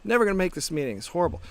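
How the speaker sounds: imitating his own stressed ego; frustrated inner voice. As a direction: ham up the stuck-in-traffic panic. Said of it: "I'm like".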